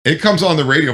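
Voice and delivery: ordinary talk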